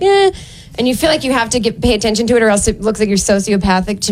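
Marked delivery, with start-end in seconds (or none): 0.0-0.3 put-on high little baby "eh"; brief high-pitched infant coo; imitated baby noise. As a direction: squeak like a smiling baby. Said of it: "the kid is just smiling at me like".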